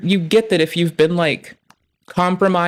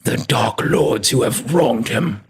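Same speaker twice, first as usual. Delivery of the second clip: mock epic narrator; 6 semitones lower, slower than usual. Echoing the dramatic video narrator.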